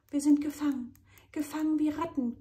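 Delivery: high, loud panicked cry; panicked gasping. A narrator's reading. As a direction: gasp it out in panic. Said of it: "keucht Ramses".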